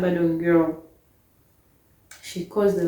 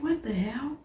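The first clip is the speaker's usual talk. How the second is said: breathy whispered aside, higher; disbelieving whisper; 6 semitones higher, 7 dB quieter than usual. Retold or imitated. Hearing the roommate accused her of theft, stunned whisper. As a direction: hiss it under your breath in shock.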